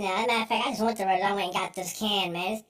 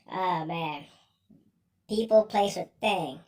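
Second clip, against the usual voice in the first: ordinary talk, nothing marked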